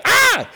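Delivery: sudden high, loud mock scream; snakebite yelp. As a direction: yelp as if bitten. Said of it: "That's how I feel when a snake bites you".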